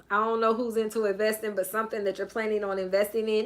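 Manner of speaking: ordinary talk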